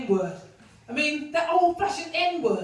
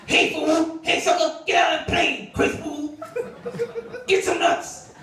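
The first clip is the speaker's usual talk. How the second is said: Mister T impression; 3 semitones higher, slower than usual. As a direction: shout it like a mock Mister T. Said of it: "They might cast him as Mister T".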